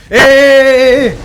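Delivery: sudden loud high yells of alarm; startled gamer hollering at the screen; alarmed shouting. Real, not acted. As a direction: yell out as the dragon swoops. Dragon attacks him in game.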